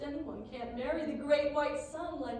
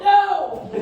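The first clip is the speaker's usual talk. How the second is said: laughing no; 5 semitones higher, 10 dB louder than usual. Acted. Mock outrage at the maid.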